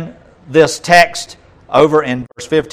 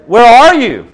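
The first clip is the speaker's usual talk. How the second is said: calling out; 8 semitones higher, 9 dB louder, slower than usual. Acted. God calling to the man.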